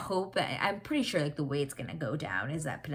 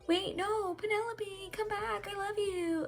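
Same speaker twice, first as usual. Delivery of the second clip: much higher, pleading, near tears; desperate plea. Retold or imitated. Colin begging Penelope not to leave, high crying plea. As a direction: beg her to come back. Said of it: "he's gonna be like".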